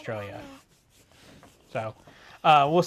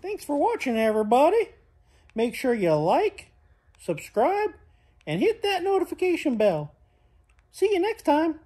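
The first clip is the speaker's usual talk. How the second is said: cheerful outro; 11 semitones higher than usual. Acted. High sing-song outro to toddler.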